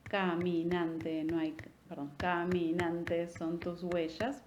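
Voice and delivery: slow, every syllable tapped out as a beat; marking the eight beats of "huellas" line for students, slow. Shown as a demonstration. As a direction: count "Caminante son tus huellas" in eight even beats. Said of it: "fueron ocho golpecitos".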